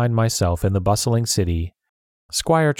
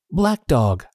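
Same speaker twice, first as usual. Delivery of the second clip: cried out; 5 semitones higher than usual. A narrator's reading. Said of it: "I cried".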